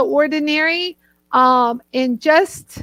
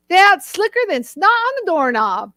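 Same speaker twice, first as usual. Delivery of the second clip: imitated Southern guys, pitched up; Southern accent; 5 semitones higher than usual. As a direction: play a folksy Southern man. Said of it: "a Southern accent".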